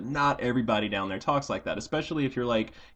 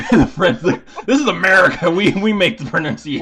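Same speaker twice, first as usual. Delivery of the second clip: mock patriotic; 7 semitones higher, 10 dB louder than usual. Joking American pride.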